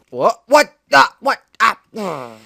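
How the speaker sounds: high, loud confused yelp; shocked at losing; startled. Real, not acted. Sudden fail in game.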